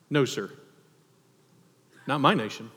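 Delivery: ordinary talk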